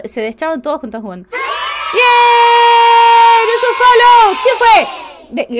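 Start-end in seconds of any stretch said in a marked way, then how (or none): 1.3-5.6 much higher, louder burst of joy; excited cheer. Real, not acted. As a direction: cheer the new follower. Celebra un nuevo follow. (grito muy agudo de alegría por el follow)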